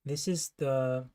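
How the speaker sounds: ordinary talk, nothing marked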